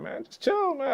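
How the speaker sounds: squeaky high mock protest; brushing off his groomsman in a high squeak. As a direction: jump way up high to brush him off. Telling groomsman to relax.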